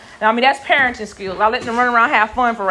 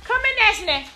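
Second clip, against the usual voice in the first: calling sweetly; 8 semitones higher than usual. Real, not acted. Calling the children to her.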